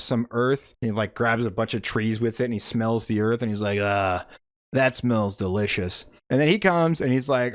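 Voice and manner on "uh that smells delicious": low, relishing giant voice; savouring villain. Retold or imitated. Galactus smelling a handful of Earth, savouring. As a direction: sink lower and savour the smell.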